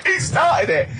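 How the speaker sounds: high, laughing protest. Real, not acted. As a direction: giggle it out defensively. Laughing about the glasses story.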